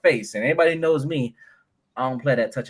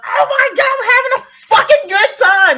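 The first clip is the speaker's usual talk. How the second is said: mock party girl squeal; 10 dB louder than usual. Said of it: "and she was like".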